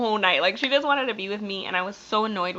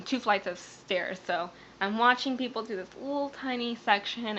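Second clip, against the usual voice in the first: normal talking voice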